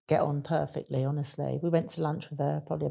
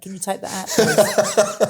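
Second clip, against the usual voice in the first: laughing plea; 3 semitones higher, 9 dB louder, slower than usual. Retold or imitated. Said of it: "He went".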